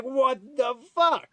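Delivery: high, slow "What the fuck?"; incredulous outburst. Retold or imitated. Facing police with guns drawn, high stretched disbelief.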